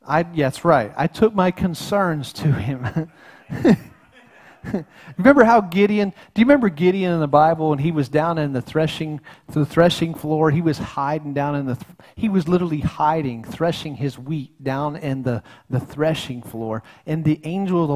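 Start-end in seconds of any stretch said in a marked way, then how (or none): none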